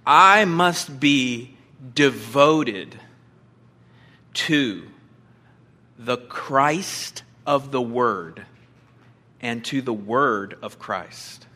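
very slow, each phrase set apart by long pauses; slow solemn statement of the point. Shown as a demonstration. Preacher to congregation, stating his main point, slow and solemn. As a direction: lay down the point slowly and deliberately. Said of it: "and it is this".

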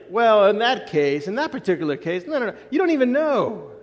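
a hint of God brushing the question off; voicing God. Preacher voices God answering the Sadducees, mildly amused.

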